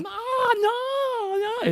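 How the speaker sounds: put-on very high, wailing man-child sob; whiny crying. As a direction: cry like a refusing child. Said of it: "It's like".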